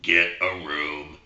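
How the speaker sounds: a low, slow little frog calling out the club; talking frog. Acted. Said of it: "Frog goes".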